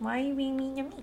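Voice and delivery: put-on soft, whiny little-kid voice; baby voice. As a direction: pout it like a baby. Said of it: "I just use my baby voice".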